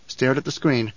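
ordinary delivery, nothing marked

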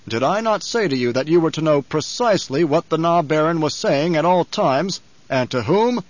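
Baron's wrathful, measured interrogation; menacing Baron. Acted. Baron rebuking his servant, menacing interrogation. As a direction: press each word home in accusation.

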